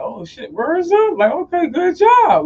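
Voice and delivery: high-pitched, impressed dad reaction; proud dad. Retold or imitated. Father hearing his son finished the job, high proud exclaiming. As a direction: stretch it out high, like a delighted father. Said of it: "almost in tears and shit".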